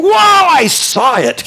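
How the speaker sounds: high, slow, awestruck gasp of wonder; amazed wonder. Acted. Elisha marvelling at the whirlwind, high and awestruck. Elisha watches Elijah taken up.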